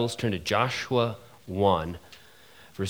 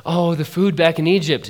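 louder, higher mock whine; mock whining; 7 semitones higher, 8 dB louder than usual. Preacher mimicking Israelites, loud higher mock whine. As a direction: whine like a spoiled crowd.

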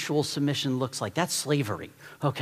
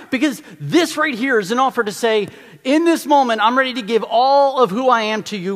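high, loud, passionate sermon push; fired-up preaching; 6 semitones higher, 7 dB louder than usual. Acted. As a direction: preach it with everything you've got. Preaching at full force.

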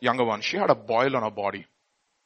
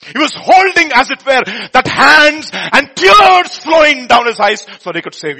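high and loud, reliving the pain; impassioned preaching; 11 semitones higher, 17 dB louder than usual. Acted. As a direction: cry it out, caught up in the memory. Preaching at full force.

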